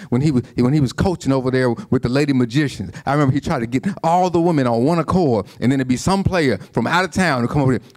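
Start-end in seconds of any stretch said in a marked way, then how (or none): none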